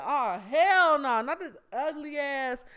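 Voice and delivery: slow, loud "hell no" with heavy emphasis; drawn-out, scornful brush-off; loud retort. Retold or imitated. Telling off the man in the grocery store, loud and slow. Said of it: "Yes, I said it".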